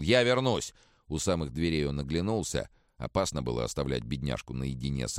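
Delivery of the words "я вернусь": loud, high shouted vow. A narrator's reading. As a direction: yell it back from the door. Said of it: "крикнул". (громкий крик)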